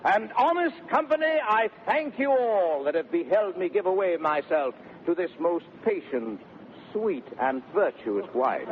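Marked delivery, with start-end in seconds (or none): none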